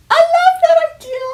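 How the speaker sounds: very high, loud squeal of delight; thrilled squeal. Real, not acted. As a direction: squeal it with real excitement. Thrilled by her own idea.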